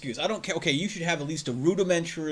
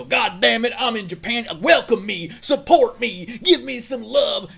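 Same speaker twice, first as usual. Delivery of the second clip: higher, louder mock demand; mock entitled tourist; 7 semitones higher, 6 dB louder, slower than usual. Imagining himself in Japan, higher louder mock demand. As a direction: whine like an entitled visitor. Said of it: "go to fucking Japan and go".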